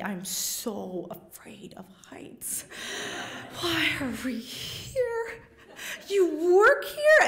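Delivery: whispered fear rising to a squeak; hushed terror building to a high yelp; scared inner thoughts. Retold or imitated. At the canyon edge, thinking to herself, scared whisper to yelp.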